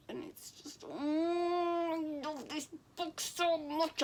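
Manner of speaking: choking up with happy tears; tearful. Real, not acted. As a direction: voice catching, high and crying. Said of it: "not read it once without crying".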